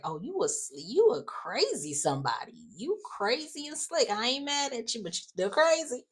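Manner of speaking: high-pitched mock Leslie voice, not angry; playful Leslie impression. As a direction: tease him like an amused older woman. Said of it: "And it was just like".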